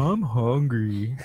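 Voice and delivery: very slow, drawn-out co-worker impression; Kurt voice. Showing off an impression of a co-worker, slow mock voice. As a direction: stretch it out slowly, mimicking a co-worker. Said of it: "That's my Kurt voice".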